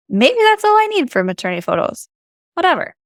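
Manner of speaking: carefree shrug. Real, not acted. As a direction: toss it off lightly. Shrugging it off, high.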